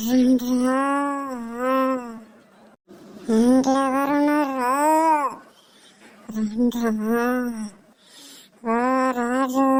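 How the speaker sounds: eerie drawn-out moans and calls; feigned ghost possession. Pretend. Mother faking possession to make her family obey, ghostly wailing. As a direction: wail slowly like someone pretending to be possessed. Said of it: "దయ్యం పట్టినట్టు చేస్తేనే".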